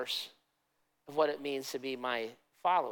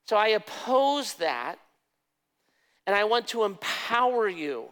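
impassioned preaching; 4 semitones higher than usual. Acted. Preaching Jesus' voice at full force.

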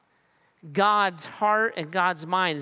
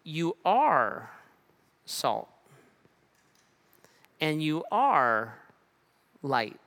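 tender reassurance with long pauses; gentle affirmation; much slower than usual. Shown as a demonstration. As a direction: lay it down gently, as a promise. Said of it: "He says".

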